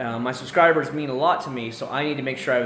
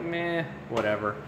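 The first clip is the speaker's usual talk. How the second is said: mock indifference; 3 semitones lower, 7 dB quieter than usual. Can't be bothered to tint car.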